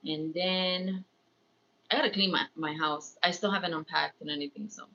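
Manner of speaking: ordinary talk, nothing marked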